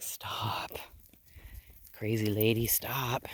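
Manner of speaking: whispered pretend sheep complaint. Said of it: "He's like".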